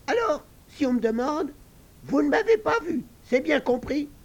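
high, loud squeaky pig voice, giving orders; the little pig laying down the rules; bossy piglet. Acted. Little pig orders the girls to deny seeing him, high and bossy.

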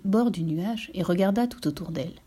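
usual speaking voice

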